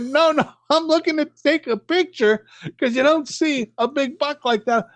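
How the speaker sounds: high-pitched, nervous retold protest; flustered explanation. Driver explains to armed wardens, high flustered voice. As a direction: squeak out an excuse to the wardens.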